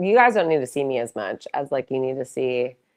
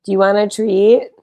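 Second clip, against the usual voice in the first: normal talking voice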